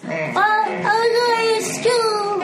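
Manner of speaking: put-on high, slow singsong kid voice; little child. Small child answering an adult about hobbies, high slow singsong. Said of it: "So, Jeffrey".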